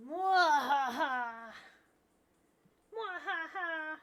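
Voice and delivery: slow hammed-up evil laugh; sneering panto villain. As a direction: cackle like a pantomime baddie. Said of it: "villain era".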